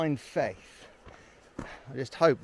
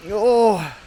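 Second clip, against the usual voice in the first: surprised; 6 semitones higher, 9 dB louder than usual. Real, not acted. Sees view from bench.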